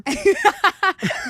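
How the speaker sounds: loud, high cackling laugh; real burst of laughter. Real, not acted. Reacting to co-host's rude joke, loud real laughter.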